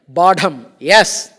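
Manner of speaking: loud, emphatic Badham, yes. Shown as a demonstration. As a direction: hit Badham hard and loud. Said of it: "His answer is yes, an emphatic yes".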